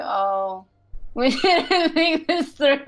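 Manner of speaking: high, slow, laughing through it; laughing mock regret. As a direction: playfully imitate our future selves. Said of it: "and we're gonna be like".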